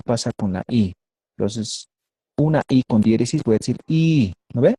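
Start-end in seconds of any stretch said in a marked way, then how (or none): 3.9-4.3 "I" held for two beats; lengthened vowel. Shown as a demonstration. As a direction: stretch the "I" in one unbroken sound. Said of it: "Voy a alargar".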